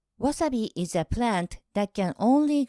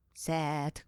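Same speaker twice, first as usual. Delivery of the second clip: softer, lower little sigh of a word; a soft mock-sad aside; 6 semitones lower, 8 dB quieter than usual. Real, not acted. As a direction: drop it quietly like a small letdown. Verdict on wasabi and cheese.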